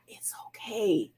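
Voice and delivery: slow whisper of reassurance. Real, not acted. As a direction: breathe it out softly, slowly. Soft reassurance after "if you're not.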